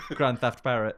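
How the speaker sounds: ordinary talk, nothing marked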